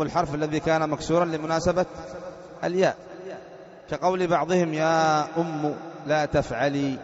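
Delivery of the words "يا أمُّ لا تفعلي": slow reading with "أم" as ummu; damma on mim. Shown as a demonstration. Teacher reads the example to students, slowly, "أم" as ummu. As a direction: end "أم" on a clear u after the mim. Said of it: "بضم الميم".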